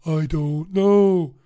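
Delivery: slow, drawn-out vacant I don't know; slow clueless health worker; mock clueless caller. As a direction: stretch it out blankly, like a caller with no answers. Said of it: "she said".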